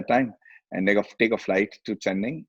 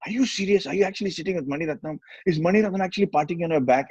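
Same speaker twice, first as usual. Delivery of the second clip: disbelief; 7 semitones higher than usual. Retold or imitated. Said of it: "I was like".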